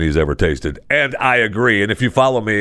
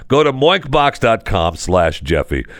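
ordinary delivery

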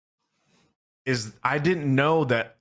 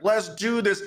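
higher-pitched, eager rally to oneself; pumped-up battle cry; 12 semitones higher than usual. Retold or imitated. Player walking through the fog to the boss, pumped up. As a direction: psych yourself up for the fight. Psyching up before boss fight.

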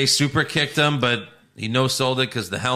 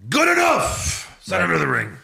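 Vince; 4 semitones higher than usual. Pretend. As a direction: bark out a hasty approval, hammed-up. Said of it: "Vince is probably like".

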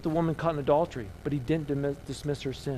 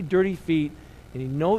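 normal talking voice

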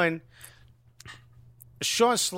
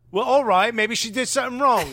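higher, louder put-on objector; mock protest; 5 semitones higher, 4 dB louder than usual. Host mimicking men who blame the woman, mock protest. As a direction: raise the voice in mock reasoning. Mocking men excusing the act.

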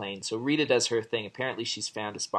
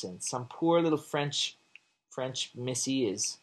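usual speaking voice

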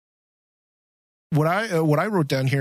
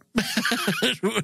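a high burst of laughter; 9 semitones higher than usual. Real, not acted. Laughs at own idea.

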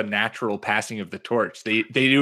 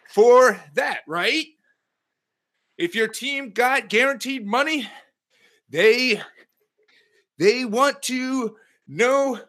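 high, slow Western gold miner twang; Antonio Brown impression; 9 semitones higher than usual. Podcaster mimics Antonio Brown's video while doing high knees, twangy impression. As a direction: do Antonio Brown like an old prospector, panting. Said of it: "what Pete would sound like doing an Antonio Brown impression".

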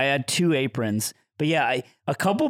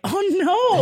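much higher, drawn-out sympathy; high sympathetic oh no; 5 dB louder than usual. Retold or imitated. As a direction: squeak it out with pity. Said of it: "I was like".

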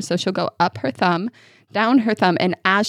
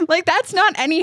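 fast and laughing into a high squeal; laughing while showing a silent scream; 10 semitones higher than usual. Real, not acted. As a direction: giggle through it, then squeal. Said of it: "you did the silent scream".

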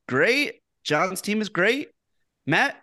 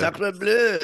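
mock French accent; 3 semitones higher than usual. Joking about the drunk Frenchman, light mock French accent. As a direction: toss off a cartoon French exclamation.

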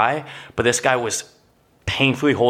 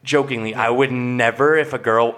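ordinary delivery

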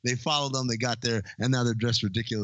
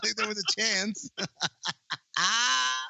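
words broken up by real laughter, pitched up; laughing while talking; 9 semitones higher than usual. Real, not acted. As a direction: talk through the giggles. Still laughing at the movie line.

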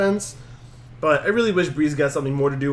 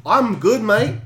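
a clumsy, hammed-up Australian accent, higher and louder; bad Australian accent; 7 semitones higher, 5 dB louder, slower than usual. Finn Balor brushing off Lashley, in a bad mock Australian accent. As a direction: toss it off in a terrible fake Australian accent. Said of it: "That's Australian".

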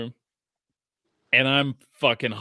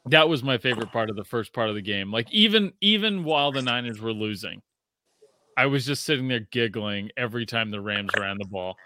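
usual speaking voice

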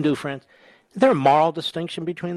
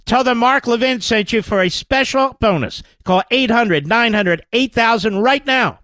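loud, high, pushy pitchman read; 8 semitones higher, 6 dB louder than usual. Acted. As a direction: sell it hard like a radio ad. Host reads sponsor ad.

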